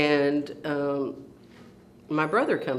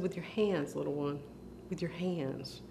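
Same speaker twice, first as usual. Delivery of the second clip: soft, tender explanation; gentle big brother; 7 dB quieter than usual. Retold or imitated. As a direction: imitate breaking it kindly to a little child. Brother gently reveals the answer.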